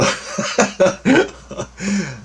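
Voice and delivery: a high falling laugh at the absurdity. Real, not acted. After waking from the dream, high amused laugh.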